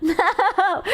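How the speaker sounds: laughing, half-crying no no. Retold or imitated. Streamer laughing in despair at her teammate's play. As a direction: laugh through a helpless no. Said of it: "And I'm just like".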